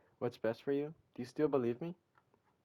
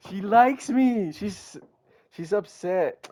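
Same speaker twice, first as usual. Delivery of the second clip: stunned realization; 6 semitones higher, 7 dB louder, much slower than usual. Real, not acted. Realizing Sayori's feelings.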